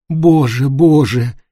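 understated keening plea to God; wailing lament. A narrator's reading. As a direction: lament it with a touch of rising despair. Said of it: "снова запричитала Эллен и заплакала".

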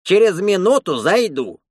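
high-pitched warning call. A narrator's reading. Attendant warning the bathers through the wall, calling out. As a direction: holler a warning from the next room. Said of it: "крикнул из-за стены дед".

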